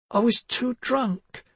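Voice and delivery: slow, high tearful words; weeping. Acted. Girl weeps to her grandmother and parents. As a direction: weep it out. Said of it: "Missa wept".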